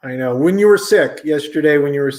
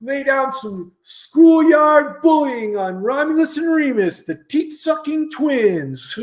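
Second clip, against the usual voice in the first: Bernard; 10 semitones higher than usual. Acted. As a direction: jeer like a schoolyard bully. Plays schoolyard bully Bernard.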